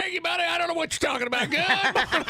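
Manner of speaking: hammed-up prospector shout; grizzled prospector. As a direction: holler like a wild old miner. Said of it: "Talk Like a Grizzle Prospector bit".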